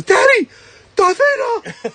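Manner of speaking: put-on very high, loud child's cry of excitement; excited little son. Son spotting Darth Vader at the door, high excited kid voice. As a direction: squeal it like a thrilled little boy. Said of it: "he turns to me and he goes".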